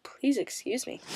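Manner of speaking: soft whispered excuse me; hushed apologetic whisper; whispered apology. Real, not acted. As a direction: breathe the apology almost silently. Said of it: "My coughs".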